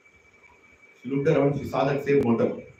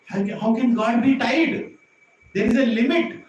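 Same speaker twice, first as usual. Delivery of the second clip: rising wonder; 8 semitones higher, 5 dB louder than usual. Real, not acted. Preacher rises in wonder.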